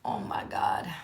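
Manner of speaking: low unvoiced whisper; whispered dismay. Real, not acted. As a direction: breathe it out in dismay. Dismay at no jersey.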